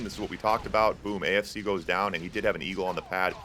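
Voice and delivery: usual speaking voice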